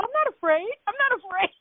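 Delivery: high, nervous insistence, said twice; mock defensive denial. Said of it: "you sound afraid".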